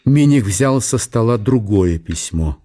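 usual speaking voice

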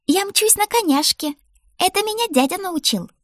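put-on very high child voice, thrilled; excited little girl. A narrator's reading. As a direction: show off proudly like a small child. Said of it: "делилась заветной информацией Мишель". (восторженный детский голосок)

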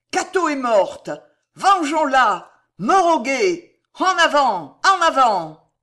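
high, loud rallying shouts; deafening battle cries. A narrator's reading. Furious women filling the corridor with war cries, shouting loudly. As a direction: cry out for vengeance at full voice. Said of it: "elles remplirent le couloir de leurs cris assourdissants".

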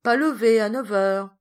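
jovial mock scolding; laughing tease. A narrator's reading. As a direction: tease a lazy son, loudly. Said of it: "dit-il en riant".

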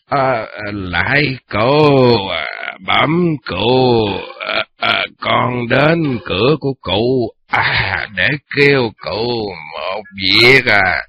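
put-on slow, slurred drunken voice; drunk slurring. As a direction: slur the words like a drunk. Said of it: "Giọng hắn là nhè ... méo mó".

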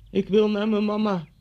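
put-on high, loud child's cry for mum; wailing little boy. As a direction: imitate a frightened little boy calling out in tears. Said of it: "riep hij". (hoog, luid huilend jongetje nadoen)

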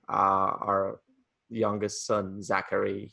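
ordinary talk

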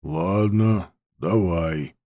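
low, quiet, grudging. Acted. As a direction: give in reluctantly. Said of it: "хотел уже рявкнуть".

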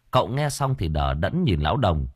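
ordinary delivery

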